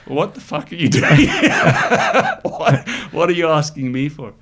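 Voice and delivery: hammed-up laughing disbelief; counsellor's laughing outburst. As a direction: laugh through it as the amused counsellor. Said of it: "counselling us said".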